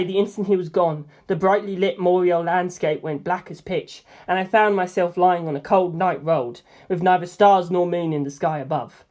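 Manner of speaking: usual speaking voice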